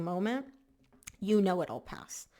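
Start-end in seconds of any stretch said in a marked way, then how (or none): none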